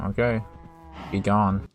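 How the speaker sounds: ordinary talk, nothing marked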